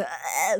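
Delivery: low mock grunt of a straining child; imitated weird straining noise. Teacher mimics crying toddler about to poop, weird straining grunt. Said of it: "那个怪声".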